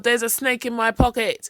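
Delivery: loud, high alarmed yell. Acted. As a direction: blurt it out at full volume. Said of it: "Possum yelled".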